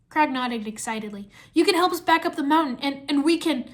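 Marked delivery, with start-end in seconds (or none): 1.5-3.7 higher, louder hopeful urging; eager. A narrator's reading. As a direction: push the plan eagerly. Cole pleads for another try.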